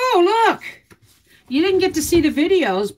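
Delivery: high and loud, thrilled; excited. Real, not acted. Showing viewers a photo, high excited burst. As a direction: burst out with delight.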